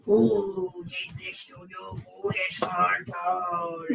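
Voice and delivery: slow, booming, shouted demon voice; scary voice. Acted. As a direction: boom it out like a demon lord. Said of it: "I was trying to sound scary".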